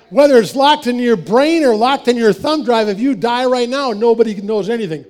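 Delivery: forceful warning to a smug friend; emphatic retort. Retold or imitated. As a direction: drive the point home hard. Emphatic rebuttal, loud.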